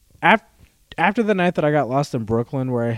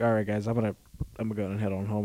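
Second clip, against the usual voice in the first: lower, softer, low-key goodbye; quiet excuse; 5 semitones lower, 8 dB quieter, faster than usual. Retold or imitated. Telling friends he is leaving, lower and soft. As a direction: slip out without drawing notice.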